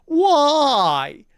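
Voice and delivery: high, drawn-out mock wail; exasperated, high-pitched "why". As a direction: stretch it out high in disbelief. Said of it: "where it's just like".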